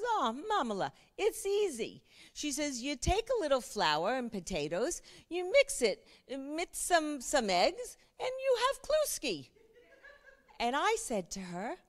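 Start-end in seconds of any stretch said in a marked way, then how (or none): 0.0-9.5 bad Middle Eastern accent. Survivor Regina explaining kluski to the author, in a hammed-up, high Middle Eastern accent. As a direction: play the old Polish mother breezily explaining her recipe in a hammed-up Middle Eastern accent. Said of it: "I do the worst Middle Eastern accent".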